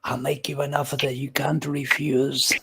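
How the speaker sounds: hushed, menacing whispered offer; mobster whisper. Acted. Recalling sinister film villains, menacing whisper. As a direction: breathe the threat like a Godfather don.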